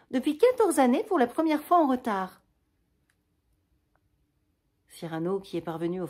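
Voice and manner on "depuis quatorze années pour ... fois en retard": gronderie affectueuse, voix plus haute et plus forte; amicale gronderie. Acted. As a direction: reproche tendre, haut et appuyé. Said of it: "sur un ton d'amicale gronderie". (gronder gentiment un vieil ami, plus haut et plus fort)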